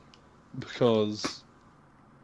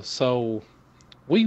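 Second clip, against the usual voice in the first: usual speaking voice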